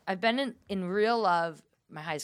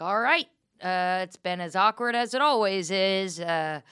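loud, halting goodbye with hesitant fillers; awkward sign-off; 2 semitones higher, 8 dB louder than usual. Retold or imitated. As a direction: wrap up an uncomfortable phone call with forced casualness. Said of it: "It was really awkward".

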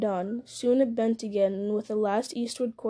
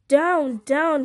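loud, raised cry of command; gasped command; 4 semitones higher, 7 dB louder than usual. A narrator's reading. Frodo throwing off Gollum, a gasped loud command. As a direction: cry out as if fighting off an attacker. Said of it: "he gasped".

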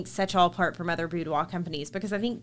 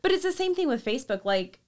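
ordinary talk

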